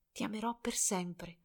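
very soft, weak and fading; faint voice. A narrator's reading. Dying girl answering Albert, very faint and soft. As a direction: speak so faintly he must lean in. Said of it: "con la voce così flebile".

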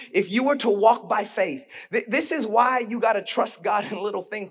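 ordinary talk, nothing marked

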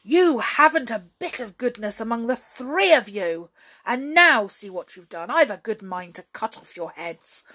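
loud, threatening rebuke; angry scolding. A narrator's reading. King threatening the brownies, in a temper. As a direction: berate them forcefully. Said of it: "roared the king in a temper".

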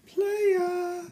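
high-pitched playful "playa"; teasing high cheer. Pretend. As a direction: squeal it like a teasing friend.

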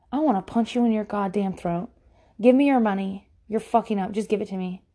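normal talking voice

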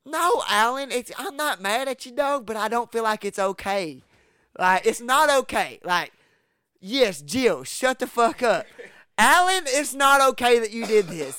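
retold exasperated shout; heated scolding. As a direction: imitate scolding a reckless buddy, half laughing. Narrator scolds Alan.

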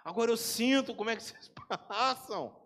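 ordinary talk, nothing marked

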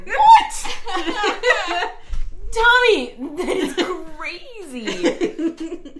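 high, squawked mock astonishment; hammed-up shock, breaking into laughs; mock shocked disbelief. [name] reacts to Tommy staying, mock shocked.

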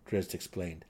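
plain reading voice

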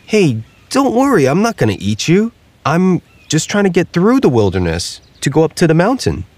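high, reassuring alligator voice; calm the scared turkey down; Ignacio the alligator. Acted.

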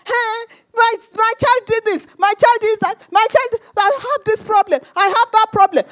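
acted overwrought caller; frantic complaining. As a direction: pour out trouble after trouble in agitation. Caller pouring out troubles.

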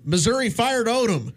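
mock yell from another room; retold yell. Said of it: "my girlfriend's dad yells".

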